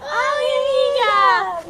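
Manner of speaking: slow, high, stretched out cry; drawn-out gush. Acted. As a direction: gush the word out slowly. Drawn-out gushing greeting.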